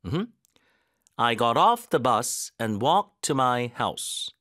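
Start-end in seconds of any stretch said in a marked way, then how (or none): 1.2-4.3 slow, "off" stressed; weight on "off"; slow stress drill. Shown as a demonstration. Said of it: "강세를 어디다 넣어야 되는지".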